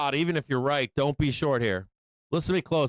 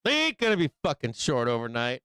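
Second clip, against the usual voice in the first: ordinary delivery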